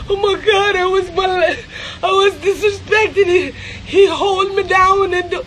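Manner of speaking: high, tearful imitation of a woman playing victim; hammed-up wail begging for sympathy; mock bawling. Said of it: "look sympathy and bawling".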